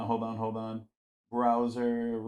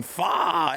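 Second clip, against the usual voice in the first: raised, drawn-out mock shout; imitated yell; 7 semitones higher than usual. Host shows friends the video's repeated sound, mock yell. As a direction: mimic a yelled sound effect. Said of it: "just him yelling".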